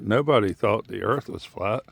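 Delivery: ordinary talk, nothing marked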